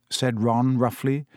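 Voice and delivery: usual speaking voice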